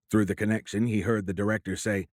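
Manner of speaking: ordinary delivery, nothing marked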